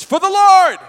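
rally the troops with a cry; full-throated battle shout. Shown as a demonstration. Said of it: "and shout this".

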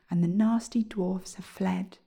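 plain reading voice

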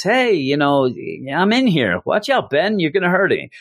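hint of a trapped Reed pleading; mock Reed voice. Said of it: "starts talking with Reed's voice".

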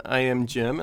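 ordinary talk, nothing marked

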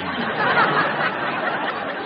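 high breathy burst of laughter; airy laugh. Real, not acted. Host laughing between contestants. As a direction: let the laugh escape.

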